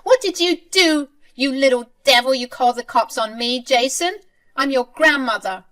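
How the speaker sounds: put-on shrieking high and loud at the boy; a grandmother losing it at the child who called the cops; crazy furious grandmother. Grandmother screaming at grandson over calling the cops, furious high shriek. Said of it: "Anne absolutely went crazy at him".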